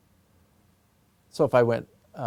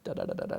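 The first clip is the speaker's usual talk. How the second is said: low soft "da" beats; pulse syllables; 6 dB quieter, much faster than usual. Shown as a demonstration. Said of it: "you see how I started with just".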